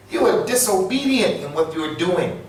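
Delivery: ordinary delivery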